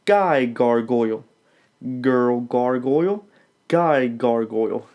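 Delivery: put-on "Girl gargoyle, guy gargoyle" slowly, each g crisp; steady, deliberate articulation; slow diction drill. Shown as a demonstration. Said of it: "say with me now".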